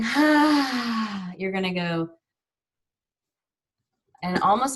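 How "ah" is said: ah, a loud open sigh out; audible sigh. Shown as a demonstration. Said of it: "instead of going".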